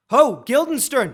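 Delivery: loud, high shout to someone off; loud royal summons; calling out. Acted. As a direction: summon him across the hall. King calls out for his courtier.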